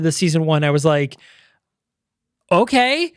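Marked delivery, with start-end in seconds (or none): 2.5-3.1 mock-baffled enthusiasm, higher-pitched; bewildered approval. Podcaster recalling the end of season one, bewildered approval. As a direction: act out being confused but on board.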